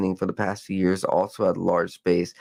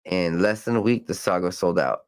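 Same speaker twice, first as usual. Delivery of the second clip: usual speaking voice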